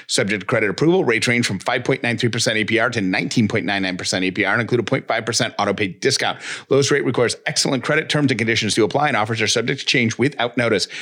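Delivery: rapid fine-print read; fast legal disclaimer. Acted. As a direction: rattle off the legal terms quickly.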